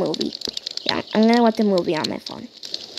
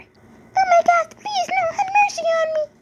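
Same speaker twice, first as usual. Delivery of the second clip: pleading victim. Acted. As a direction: cry out for mercy, high and stretched. Victim begging the monster.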